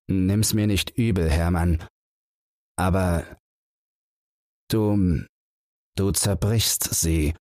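slow and heavy, slowing most at the end; slow words. Acted. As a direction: let each word fall slowly. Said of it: "streut Bang die langsamen Worte". (Bang spricht langsam und schwer zu Holzer)

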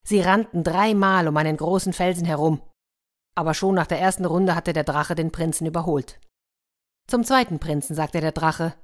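usual speaking voice

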